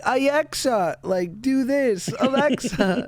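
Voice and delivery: put-on kids calling Alexa. Kids pestering Alexa all day, mildly mimicked calling out. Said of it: "it's just like".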